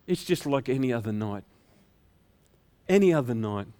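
low, subdued resignation; weary routine. Acted. Shepherds on an ordinary night, low and weary. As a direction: sound worn down by the sameness.

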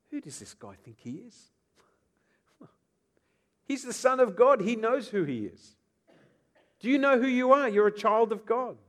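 high, loud scornful mockery; scoffing leaders. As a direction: sneer it like scornful priests. Pastor voicing scoffing leaders.

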